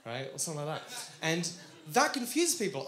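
ordinary delivery

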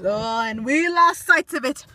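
usual speaking voice

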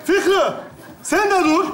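high, sharp order to stop; exasperated command. Acted. Telling Fikri to stop too, exasperated.

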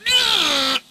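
high loud yelp; trucks crying out. A narrator's reading. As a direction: shriek as the brakes lock. Trucks cry out.

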